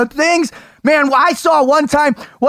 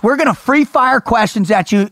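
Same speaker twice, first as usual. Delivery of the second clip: usual speaking voice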